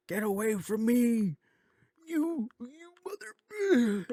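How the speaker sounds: high-pitched, drawn-out mock wail; upset child's outburst. Son lashing out at his dad, mock high-pitched outburst. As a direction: imitate a distraught kid in a squeaky, strained voice.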